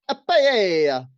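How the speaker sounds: loud, slow "pie-AY-ya"; mock Spanish paella. Hosts mocking the dish name, loud drawn-out Spanish paella.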